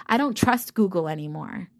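ordinary talk

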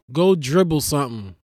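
ordinary delivery

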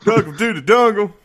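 laughing through the words; giggling over a silly slip. Real, not acted. Repeating the "Dungle" slip laughing.

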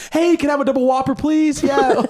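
high, loud perky customer; fake drive-thru voice. Shown as a demonstration. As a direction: burst into a high, loud polite order. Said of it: "I'm just talking like this".

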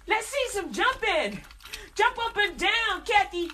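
loud, high, slow "jump up and down"; mock the crowd's demands; mock audience demand.

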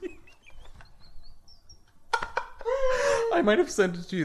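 laughing while telling the cohost. Real, not acted. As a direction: giggle your way through it. Laughing while recalling TikTok.

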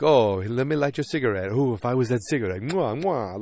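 flirty mock Italian with kissing sounds. Italian guys offering to light her cigarette, flirty mock Italian accent. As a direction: play a smitten Italian admirer. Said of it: "stereotypical Italian guys".

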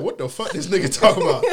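high, loud laughing confusion; laughing disbelief. Retold or imitated. Retelling the bartender chasing him, laughing in disbelief. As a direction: laugh through the question.

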